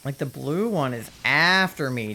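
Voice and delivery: ordinary talk, nothing marked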